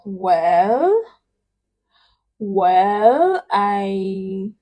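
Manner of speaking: WELL drawn out, dipping then climbing; thinking, down and up. Shown as a demonstration. Still thinking about something, dipping and rising "well". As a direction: draw "Well" down and up, still undecided. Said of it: "this down and up".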